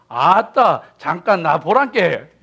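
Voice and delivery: hammed-up Jeolla local calling out; playful Jeolla accent with a chuckle; Jeolla dialect. Shown as a demonstration. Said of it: "전라도 사람은".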